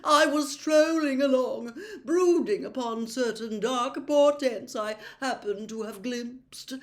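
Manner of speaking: high, slow, dreamy prophetess; mystical seer voice. Acted. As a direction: drift through it like a seer. Trelawney voice held.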